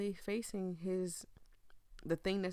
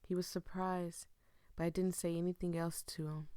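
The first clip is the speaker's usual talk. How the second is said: subdued, measured confession with long pauses; despairing game character. Acted. Giving up on life after losing a love, resigned. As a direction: read it resigned, drained of hope.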